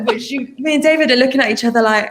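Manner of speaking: ordinary talk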